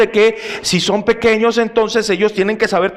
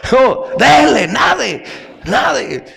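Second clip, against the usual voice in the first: shouted order; 5 semitones higher, much slower than usual. Retold or imitated. Uncles yell at the boy they threw into the water, shouting. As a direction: bark at the kid in the water to keep going.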